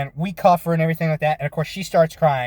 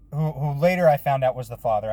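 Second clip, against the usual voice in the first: normal talking voice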